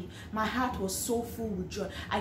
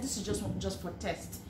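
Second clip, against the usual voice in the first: inner thought; 8 dB quieter than usual. Retold or imitated. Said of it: "In my mind, I said".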